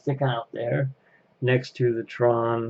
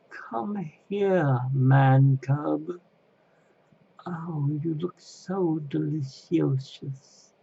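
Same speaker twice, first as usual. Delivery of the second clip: high, hissy-slow snake voice, imitating Kaa; Kaa impression; 5 semitones higher, much slower than usual. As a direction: coax like the hungry snake, slowly. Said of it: "he would talk like this and say".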